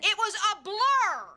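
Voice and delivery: loud, high, drawn-out protest; frustrated witness bursting out; exasperated outburst. Acted. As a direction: cry it out, stretching the last word. Witness snaps back under cross-examination.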